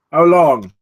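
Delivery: sharp demand. Acted. Bijan demands time to launch.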